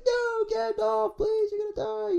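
hammed-up squeaky pleading; Frodo pleading. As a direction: whimper it like a frightened hobbit. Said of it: "And Frodo's like".

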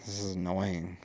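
low, slow mock groan of annoyance; retold annoyance. Man retelling his second viewing of a movie, mildly annoyed. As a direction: grumble it softly, as if bored by it.

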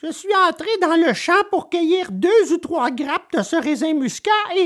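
high-pitched little puppet voice; small wooden boy making his excuse; puppet explaining himself. Acted.

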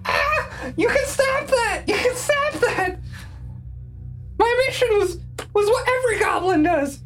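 high, pained goblin whine. Acted. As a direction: yelp and plead like a goblin. Goblin pleading under pressure.

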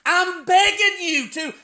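high, urgent preacher's plea; impassioned begging. Acted. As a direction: beg at full pitch, stretching the key words. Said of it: "can you see the man Jeremiah".

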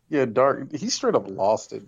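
ordinary talk

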